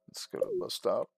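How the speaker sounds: ordinary talk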